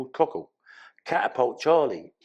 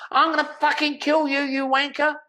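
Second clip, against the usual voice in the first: mock angry threat. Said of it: "It's just like".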